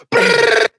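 very high, loud rolling brrr; hammed-up gunfire burst; imitated machine-gun fire. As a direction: rattle it out like an automatic weapon. Retaliation shots fired.

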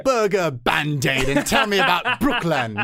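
high, hammed-up royal order; mock imperious queen. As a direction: command a servant from the throne, playfully grand. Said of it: "sitting in, like, her throne chair".

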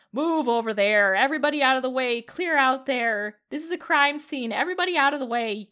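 loud commanding shout to clear the street; police shout. Acted. Centaur officer orders the crowd off the street, shouting loudly. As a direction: bellow at the crowd to get out of the way. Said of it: "a very deep voice, uh, shouting".

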